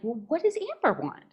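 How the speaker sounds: high, wondering voice; wondering aloud. Retold or imitated. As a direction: ask yourself in wonder. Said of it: "I began asking".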